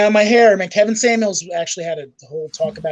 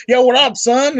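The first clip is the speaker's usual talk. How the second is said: street slang voice; 6 semitones higher, 4 dB louder than usual. Retold or imitated. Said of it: "You're not saying".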